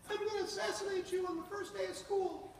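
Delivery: put-on high, eerie kid voice delivering a threat; caller's threat. The caller threatens the new teacher, high voiced. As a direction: play the prank caller high and creepy.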